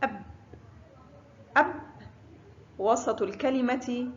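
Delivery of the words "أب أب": "أب" cut short on a vowelless b; sukun on baa. Shown as a demonstration. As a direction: say "أب" closing on b with no following vowel. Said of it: "حرف الباء فوقه سكون ينطق". (نطق "أب" بباء ساكنة)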